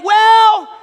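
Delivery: high, loud, stretched hedge; drawn-out loud reply. Acted. People answering God with selective hearing, loudly hedging. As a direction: belt out an evasive answer. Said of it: "And we are saying".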